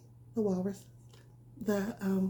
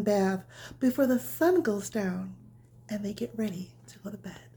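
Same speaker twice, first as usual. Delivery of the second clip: bedtime story reading; 8 dB louder, slower than usual. A narrator's reading. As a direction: read it gently like tucking a child in. Reads bedtime story to children.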